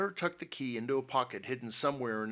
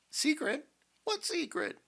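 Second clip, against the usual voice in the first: very high, startled questioning; bewildered cry. A narrator's reading. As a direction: cry out in baffled disbelief. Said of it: "cried Miss Dobson".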